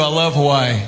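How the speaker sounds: ordinary talk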